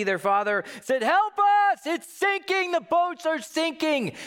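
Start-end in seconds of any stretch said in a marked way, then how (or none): 1.0-4.2 very high, loud, frantic yell; panicked cry for help. Retold or imitated. Fishermen calling to James and John, panicked. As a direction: shout like the boat is going down.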